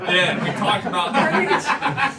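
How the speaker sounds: laughing loudly through the words. Real, not acted. Cracking up about Infinite Jest.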